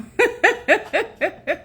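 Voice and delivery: bright high laugh bursting out; real laugh, high and quick; high burst of laughter. Real, not acted. Laughing at friend's dig.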